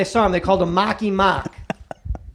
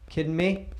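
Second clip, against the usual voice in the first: ordinary talk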